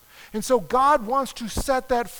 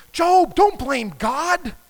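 accusing friend; 4 semitones higher, 5 dB louder, much slower than usual. Retold or imitated. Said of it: "they're gonna say".

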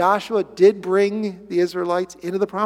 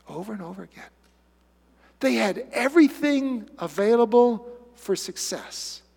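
normal talking voice

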